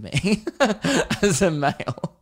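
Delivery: breaking into laughter mid-sentence; real laugh bubbling through the words. Real, not acted. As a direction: crack up as you finish, pitch jumping up. Cracks up at own words.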